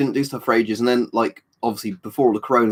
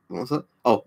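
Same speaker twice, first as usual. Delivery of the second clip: ordinary talk, nothing marked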